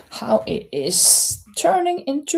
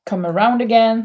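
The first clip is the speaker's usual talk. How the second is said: ordinary talk, nothing marked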